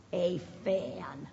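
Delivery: put-on expressive read-aloud for kids; storybook reading. A narrator's reading. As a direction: read it like story time on the rug. Reads a story to children.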